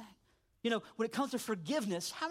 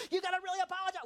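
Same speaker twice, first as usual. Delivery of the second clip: mad mom; 10 semitones higher, much faster than usual. Retold or imitated. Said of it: "my mom would get so mad at me".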